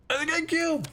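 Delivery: high, thrilled exclamation; excited. Real, not acted. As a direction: shout it out with delight. Excited at spotting the console.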